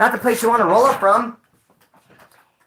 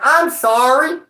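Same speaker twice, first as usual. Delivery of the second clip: high, loud imitated apology; exaggerated, blurted-out sorry; too loud; 7 semitones higher, 5 dB louder, much slower than usual. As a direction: re-enact the embarrassing blurted apology. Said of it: "it sounded so loud".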